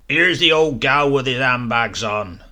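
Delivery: loud jeering call across a square; mock the parson at the top of your voice; heckling the parson from across the square; Foghorn's shout. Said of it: "Foghorn would shout across the square".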